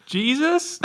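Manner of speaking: high, loud questioning reveal; spring it as a surprise punchline; mock surprise. Telling the door joke's punchline, high and loud.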